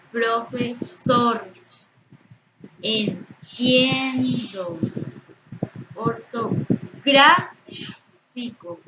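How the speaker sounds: each word slowly split, its stressed syllable long and punched; words one by one, hit the tonic syllable hard; stressed syllables exaggerated. Shown as a demonstration. Teacher giving examples of tonic syllables, stressed syllable drawn out. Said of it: "cuáles serían sus sílabas tónicas".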